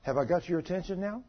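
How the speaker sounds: hushed question from God; little voice. Retold or imitated. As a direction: ask it softly, as an inner voice. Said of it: "I heard this little voice".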